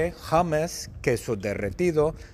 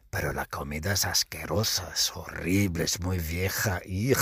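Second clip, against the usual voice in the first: low-pitched aside of disgust; asco; 7 semitones lower, 4 dB quieter than usual. Acted. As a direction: drop the voice right down in revulsion. Disgusted aside about the food. (tono grave de asco)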